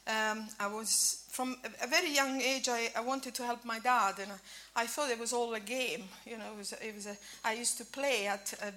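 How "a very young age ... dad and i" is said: normal talking voice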